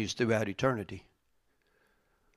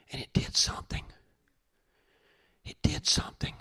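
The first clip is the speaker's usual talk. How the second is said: unvoiced, softer whisper for effect; 5 dB quieter than usual. Real, not acted. Pastor whispering to the church, hushed. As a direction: lean in and whisper it.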